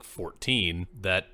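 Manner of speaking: ordinary talk, nothing marked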